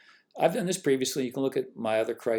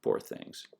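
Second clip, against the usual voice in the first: mock pity; 9 dB quieter than usual.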